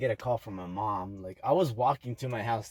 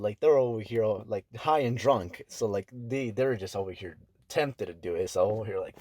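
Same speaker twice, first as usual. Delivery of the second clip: ordinary delivery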